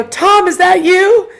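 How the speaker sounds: excited high, loud, drawn-out call-out. Retold or imitated. Guide calls to a spirit whose voice she recognized, excited. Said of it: "They were excited to see that I was excited".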